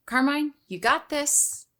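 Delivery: rally him like a coach before the big game; pep talk. Acted. Bard cheering on the scared cleric Carmine, slow and rousing. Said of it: "I'm going to say".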